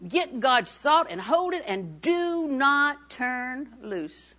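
high, emphatic sermon refrain; fervent, drawn-out exhortation; impassioned preaching. Real, not acted. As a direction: drive each repeated phrase home, stretching key words. Preaches with rising force.

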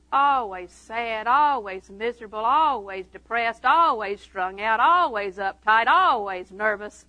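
slow chant-like list, ALWAYS stretched each time; drawn-out litany. Real, not acted. Preacher listing miserable Christians' traits, drawn-out litany. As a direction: lean long and hard on every "always".

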